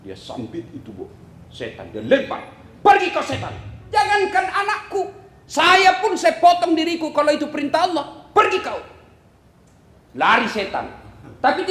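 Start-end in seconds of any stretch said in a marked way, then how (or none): 2.8-8.8 higher, forceful command to leave; angry dismissal. Acted. Ibrahim throwing stones at the devil, angry command. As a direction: drive the devil away with force.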